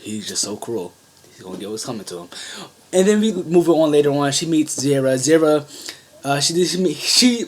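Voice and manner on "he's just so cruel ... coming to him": quiet muttered aside. Retold or imitated. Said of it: "And then I'm just like".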